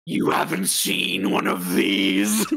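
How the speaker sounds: deep, slow crone voice; scary witch voice. Acted. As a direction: menace them slowly like an old witch. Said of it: "stop the scary voice now".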